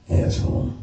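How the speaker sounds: breathy muttered insult; under my breath. Retold or imitated. Comic recalling his reply to the doctor, whispered under his breath. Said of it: "say under my breath".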